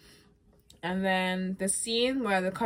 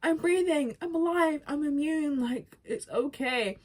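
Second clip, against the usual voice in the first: calm her friend down, voice raised; reassuring Ellie; 9 semitones higher, slower than usual. Retold or imitated. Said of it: "Ellie's like".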